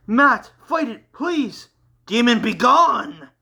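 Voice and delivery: high, loud commanding yell; a desperate shouted exorcism. Acted. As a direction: yell at the demon to drive it out. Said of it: "yelled Jakia".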